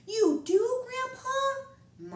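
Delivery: put-on a little boy who can't believe it; child surprised by grandpa's answer, high and bright. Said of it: "Moncho asked, surprised".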